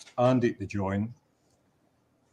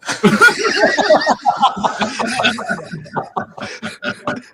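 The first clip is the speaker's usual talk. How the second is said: high wheezing laugh; 7 dB louder than usual. Real, not acted. Teller laughs at own punchline.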